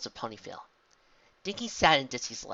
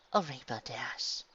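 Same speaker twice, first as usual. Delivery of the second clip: tender comfort; 4 dB quieter, slower than usual. A narrator's reading. Comforting a crying student.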